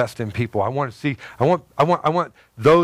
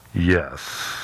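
lightly voiced God saying yes, lower; a calm divine answer; 7 semitones lower than usual. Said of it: "you could hear God go".

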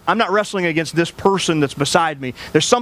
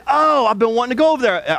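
lightly voiced woman, pitched higher; a hint of the curious woman on the bench, higher up; voicing the woman; 8 semitones higher than usual. Woman on the bench asking the pastor, lightly voiced and higher. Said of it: "She said".

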